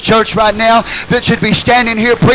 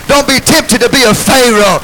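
shouted exhortation; 5 semitones higher, 6 dB louder than usual. Real, not acted. Preacher crescendo, shouted repeat.

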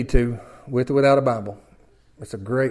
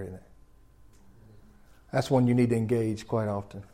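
normal talking voice

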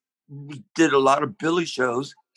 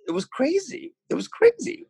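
excited. Real, not acted. Excited retelling of success.

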